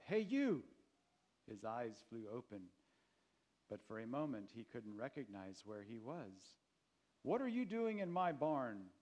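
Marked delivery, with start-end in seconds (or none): 0.0-0.6 loud, high-pitched shout. A narrator's reading. Farmer waking a boy found in his barn, shouting. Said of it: "he heard a voice shout". 7.2-8.9 loud, raised, gruff challenge; gruff demand. A narrator's reading. Farmer confronting a boy sleeping in his barn, gruff demand. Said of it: "demanded the gruff voice".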